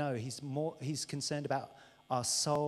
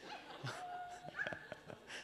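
high laugh; 14 dB quieter than usual. Real, not acted. Big laugh after joke.

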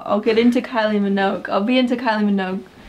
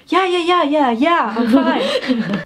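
high, rapid-fire reassurance; re-enacted flustered overreaction, higher pitched; overreacting; 4 semitones higher, 3 dB louder, slower than usual. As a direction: act out an over-eager insistence. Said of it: "Why are you reacting so much?".

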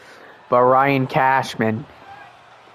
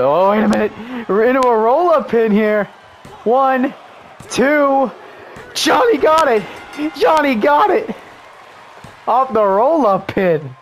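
excited commentator yell; 8 semitones higher than usual. Real, not acted. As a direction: call the pin at full hype. Roll-up pin count and win.

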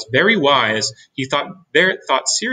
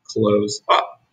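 usual speaking voice

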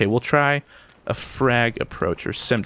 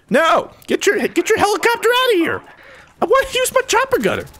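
frustrated shout. Real, not acted. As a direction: shout at the helicopter to get lost. Yells at enemy helicopter.

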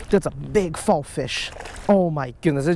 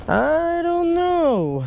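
drawn-out shrug; 13 semitones higher, much slower than usual. Real, not acted.

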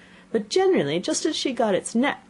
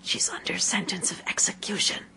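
secretive whisper; hushed, voiceless confidence; 3 semitones higher, 4 dB quieter than usual. A narrator's reading. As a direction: whisper it into her ear. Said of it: "and whispered".

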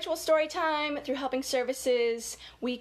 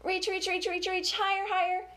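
rising reaching cue; 7 semitones higher than usual. Shown as a demonstration. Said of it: "reach up for the sky".

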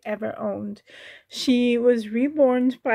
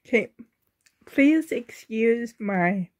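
ordinary delivery

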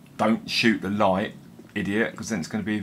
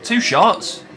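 surprised; 11 semitones higher, 8 dB louder than usual. Real, not acted. Enemy died fast.

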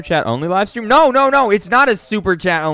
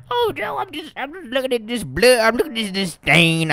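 puzzled cowboy talk pitched way up; goofy high character voice; 8 semitones higher than usual. Pretend. Streamer as a character telling Joe about the stain, high puzzled voice. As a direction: play a dim-witted sidekick squinting at a stain.

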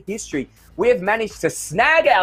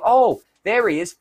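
usual speaking voice

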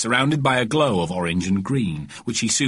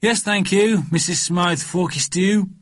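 put-on higher-pitched, dutiful child; well-mannered boy, pitched up; polite little boy; 9 semitones higher, slower than usual. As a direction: answer the grown-up politely in a kid's voice. Boy politely answers friend's mother.

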